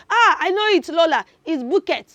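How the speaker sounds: put-on loud, high eager-child voice; a know-it-all child calling out. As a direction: play a kid blurting the answer. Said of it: "Somebody is already saying".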